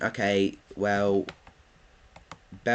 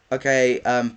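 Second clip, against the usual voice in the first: ordinary talk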